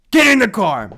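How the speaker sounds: high, loud cop command; barked order. Retold or imitated. Mean cop sending them to the car, barked order. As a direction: imitate a cop ordering loudly.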